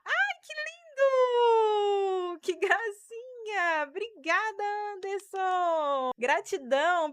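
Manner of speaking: high, long drawn-out squeal of delight; real delighted gushing. Real, not acted. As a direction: gush over a surprise gift, stretching the vowels. Delighted thanks for a live gift.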